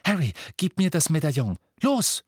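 high, urgent demand; impatient, pressing command. A narrator's reading. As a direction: order it impatiently, pitch leaping up at the end. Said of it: "sagte sie ungeduldig".

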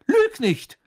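high, loud scolding shout; angry command. Acted. Mother catches son lying, angry high shout. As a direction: snap at a lying child, shrilly pitched.

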